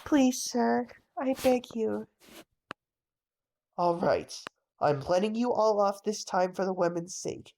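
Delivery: normal talking voice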